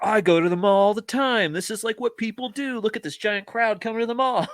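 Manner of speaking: high, gushing parody of a mall fan; mock mallgoer enthusiasm. Mocking actors who act like mall regulars, high hammed-up enthusiasm. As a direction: play it high and over-keen, mocking. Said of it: "instead of like they were".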